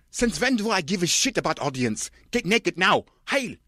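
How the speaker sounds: high, odd foreign-sounding tyrant bark; shouting director. Acted. Director ordering Kirsten to strip, high bark. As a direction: bark it like a raving auteur. Von Trier voiced shouting.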